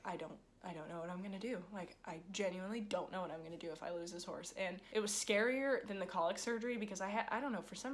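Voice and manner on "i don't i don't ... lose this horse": retold despair. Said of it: "thought I was gonna lose her".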